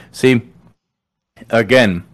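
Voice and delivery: ordinary delivery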